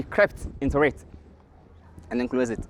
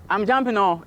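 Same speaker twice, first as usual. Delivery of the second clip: higher-pitched, lightly acted announcement; Tortoise announcing jump; 6 semitones higher than usual. Tortoise warns before leaping from tree, higher acted call. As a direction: call it out from up high.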